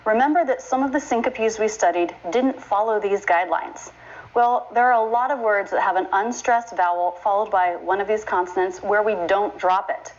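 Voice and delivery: ordinary delivery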